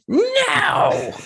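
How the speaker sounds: very high, loud mock child shriek; child's scream. Child as metal band backing, high screamed refusal. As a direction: scream it like a tantruming toddler. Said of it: "a child be like".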